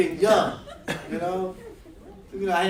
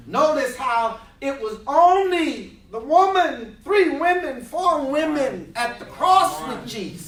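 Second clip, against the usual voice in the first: louder, higher preaching build; 6 semitones higher, 6 dB louder than usual. Acted. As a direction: rise to the pulpit climax.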